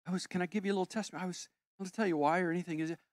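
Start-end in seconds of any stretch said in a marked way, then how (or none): none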